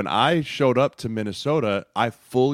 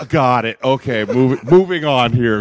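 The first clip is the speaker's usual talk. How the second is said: louder, higher awkward rush past it; flustered laughing; 3 semitones higher, 8 dB louder than usual. Retold or imitated. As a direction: hurry on to escape the embarrassment. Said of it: "I was like".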